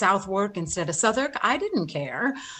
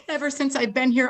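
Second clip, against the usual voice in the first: ordinary talk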